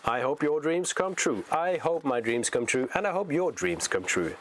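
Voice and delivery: ordinary delivery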